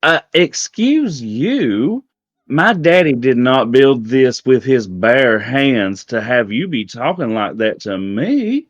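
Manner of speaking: slow, drawn-out affronted southern belle; indignant southern accent. Acted. Queen scolding a guest who talked back, indignant southern accent. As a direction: turn up the southern accent, offended and emphatic. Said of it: "about to turn it up".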